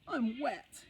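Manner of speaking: put-on high, whiny child's wail; a soaked little boy complaining; boy wails about being wet. A narrator's reading. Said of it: "he wailed".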